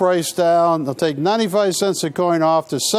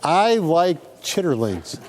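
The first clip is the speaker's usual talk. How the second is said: slow, deliberate I like chitterlings; say it slowly; slower than usual. Shown as a demonstration. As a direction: hand them the exact line, slowly. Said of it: "they gotta say".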